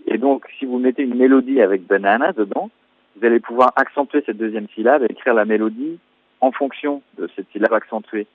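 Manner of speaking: normal talking voice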